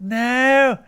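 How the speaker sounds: high, loud shout. Acted. Player shouting at the failed roll. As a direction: cry it out in dismay.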